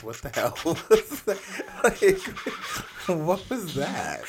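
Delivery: high, loud, through laughter; laughing in disbelief. Real, not acted. Baffled host asks what that noise was, laughing in disbelief. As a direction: crack up while demanding an explanation. Cracking up at a strange sound.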